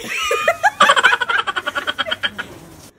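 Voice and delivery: high squealing laugh; shrieking laugh. Real, not acted. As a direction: burst out laughing. Laughing fit.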